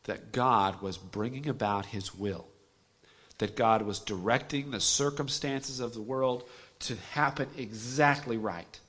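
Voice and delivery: usual speaking voice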